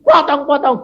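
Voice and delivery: high, loud shout of defiance; preacher shouting back defiantly at the rebels. Retold or imitated. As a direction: yell it back at the gunmen. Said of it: "Aku ya teriak gitu".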